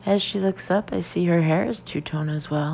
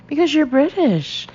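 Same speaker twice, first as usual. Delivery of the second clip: higher, louder eager burst, in a lightly changed voice; excited; 9 semitones higher, 6 dB louder than usual. Acted. Jody, thrilled to announce Becky's British, excited. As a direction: gush it like big news.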